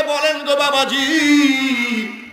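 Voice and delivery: high, slow, drawn-out plea; tearful plea. Retold or imitated. Amina begs the priest to speak, imitated tearful plea. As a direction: imitate a weeping mother's long high plea. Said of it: "কান্দে আর বলে".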